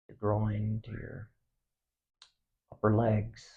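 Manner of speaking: soft, unhurried meditation voice with long pauses; hushed, spacious relaxation guidance; guided body scan meditation. Acted. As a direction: guide them gently through the body, quietly.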